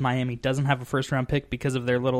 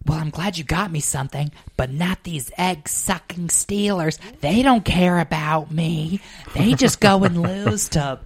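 complaining mother; 5 semitones higher, 5 dB louder than usual. Retold or imitated. Mom receiving Steelers gift, theatrically aggrieved. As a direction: build a hammed-up wounded whine, getting louder. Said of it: "she'll do this, like".

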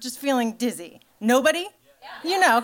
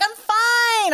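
much higher and louder, a strained squeak of denial; insisting I'm fine; 10 semitones higher, 3 dB louder than usual. Retold or imitated. To her husband after passing out, high strained insisting. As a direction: squeal it out to prove you're okay. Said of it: "and I'm like".

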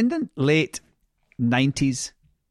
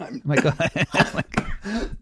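laughing. Real, not acted. Host laughs at guest choking.

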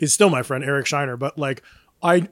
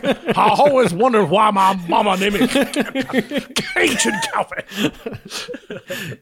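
slow drawled Cajun man; 5 semitones higher, much slower than usual. Pretend. Cajun Calpe voice, laughing.

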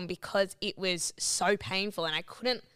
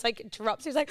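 ordinary talk

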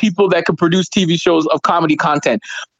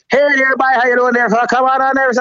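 put-on higher, slurred Newfoundland accent, Irish-Scottish lilt; mock Newfoundland accent; 4 semitones higher, faster than usual. Comic imitating locals who greet visitors, mock Newfoundland accent. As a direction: do a drunken Newfoundland accent, pitched up. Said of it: "they sound like they're Irish and drunk and Scottish".

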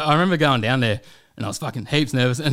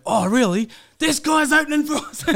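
very high, put-on voice of an amazed guard; excited security guard. Security guard learning the opener is here, excited. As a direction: squeal it as a thrilled fan. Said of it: "there was a security guard".

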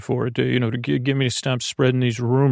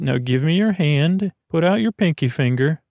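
usual speaking voice

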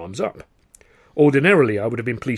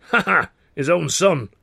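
gloating laugh; 7 semitones higher, 5 dB louder than usual. Acted. Carno gloats about rival.

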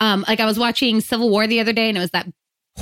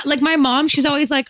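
ordinary talk, nothing marked

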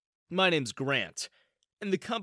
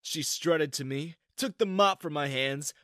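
usual speaking voice

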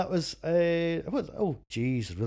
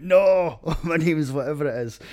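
laughing denial; 6 semitones higher, 12 dB louder than usual. Retold or imitated. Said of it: "he went".

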